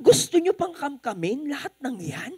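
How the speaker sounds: the waiter's mock outrage at greedy diners; incredulous waiter scolding; indignant waiter. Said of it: "sinasabi niya".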